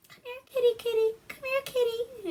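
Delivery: high falsetto kitty call; calling a cat. Retold or imitated. Aunt luring a cat in the bushes, high calling voice. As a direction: coax a cat in a very high mock voice.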